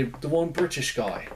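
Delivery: a quick, badly faked British accent; terrible British accent. Reviewer naming the scientist slips into a bad British accent. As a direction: toss off a clumsy British accent on the words. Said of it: "I did a terrible British accent just then".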